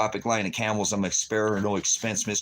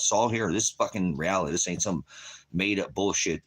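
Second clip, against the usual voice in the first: ordinary delivery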